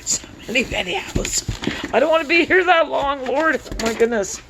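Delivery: high, breathy squeal of joyful overwhelm; a high excited squeal over too many videos. Real, not acted. Overwhelmed unboxing books.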